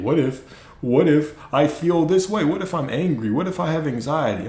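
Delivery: hammed-up fretful listener voice; mock worried follower. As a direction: mimic the anxious audience, whining.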